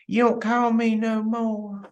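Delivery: slow, drawn-out whiny imitation; imitated needy grievance; mock complaining. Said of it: "Someone says".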